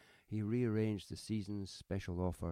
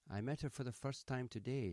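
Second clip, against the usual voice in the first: higher-pitched, mildly sharp retort; 6 semitones higher than usual. A narrator's reading. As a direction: sharpness without raising the volume. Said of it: "said the woman sharply".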